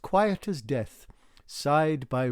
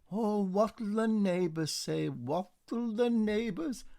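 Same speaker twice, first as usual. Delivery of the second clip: scandalised mother; 8 semitones higher than usual. A narrator's reading.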